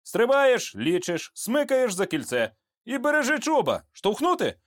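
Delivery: high-pitched soldier's coaching; urgent jump instructions. A narrator's reading. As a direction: rattle off the steps before the jump. Jump instructions at the open door.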